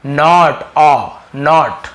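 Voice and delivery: "नॉट" with a loud, drawn-out open aw; teacher isolating the aw inside "नॉट"; right pronunciation. Shown as a demonstration. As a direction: stretch the aw in "नॉट", slow and loud. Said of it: "मैं क्या पढ़ रहा हूँ".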